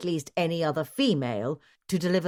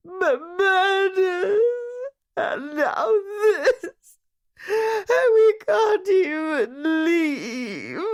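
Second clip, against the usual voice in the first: high, loud, slow tearful wail; crying; 6 dB louder, much slower than usual. A narrator's reading. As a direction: sob it out in despair. Distraught wife crying, continuing wail.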